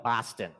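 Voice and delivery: Boston said as Bahsta, no r, dropped final n; Boston the local way, Bahsta; broad Boston Boston; Boston accent Boston. Shown as a demonstration. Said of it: "or as we call it".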